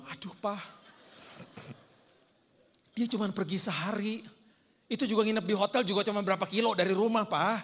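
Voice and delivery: half-laughing mock scolding, as if rolling the eyes; exasperated scolding.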